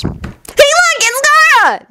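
very high-pitched eager call; excited. A narrator's reading. Naruto spots Gaara, very high and excited. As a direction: pipe up in delight. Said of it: "Naruto said excitedly".

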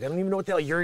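normal talking voice

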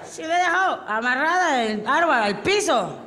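put-on muddled drunk protesting; hammed-up tipsy indignation; clueless drunk. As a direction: play a drunk missing the point. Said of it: "Y el borracho dice".

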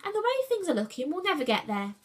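irritated, quick-starting retort; snapped. A narrator's reading. Julius answers the grumbling lion, mildly snapped. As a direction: snap back, then settle. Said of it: "snapped Julius".

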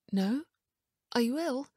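high-pitched female character, questioning; concerned wife. A narrator's reading. Wife asking if her husband is ill, concerned. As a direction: pitch up in puzzled worry. Anxious Emma questions Ian.

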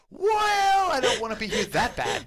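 laughing quote. Retold or imitated. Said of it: "You're like, you're like".

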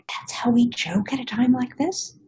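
usual speaking voice

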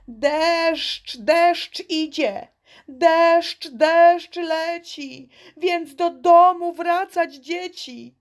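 high, slow croaking frog warning. Acted. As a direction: call out the rain warning like a little frog. Frog warns children of rain. (wysoki, ostrzegawczy głos żabki)

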